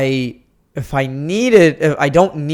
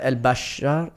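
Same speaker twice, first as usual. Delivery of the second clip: ordinary talk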